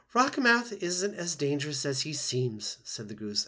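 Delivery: Loudwing calming Roz; old goose, out of breath. Acted. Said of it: "out of breath".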